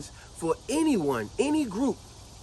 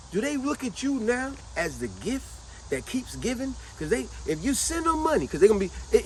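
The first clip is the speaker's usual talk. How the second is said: ordinary talk, nothing marked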